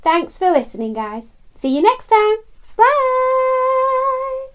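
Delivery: ordinary delivery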